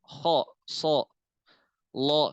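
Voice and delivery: "ho", "so", "lo" with a thick rounded o; heavy letters with o. Shown as a demonstration. Teacher showing students the thick o sound. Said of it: "ada huruf yang ada o-oan".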